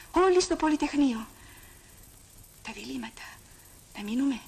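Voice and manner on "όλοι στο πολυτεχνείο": calling out. Acted. Calling everyone to occupy.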